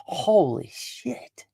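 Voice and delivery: high, slow, drawn-out disbelief; high stunned slow holy shit; stunned gasp. Retold or imitated. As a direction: stretch it out, jaw dropped in shock. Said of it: "you're left going".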